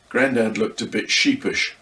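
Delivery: ordinary delivery, nothing marked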